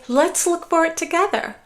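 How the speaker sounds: an eager invitation to look together; bright upbeat invitation. Shown as a demonstration. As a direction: make "for" ring out, inviting and upbeat. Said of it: "you can say".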